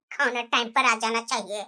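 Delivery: low, distorted mimicry of the sister-in-law's demand; the wife parrots the sister-in-law's demand back in a deep mocking voice; mocking imitation. Mocking imitation of the sister-in-law, low and sneering.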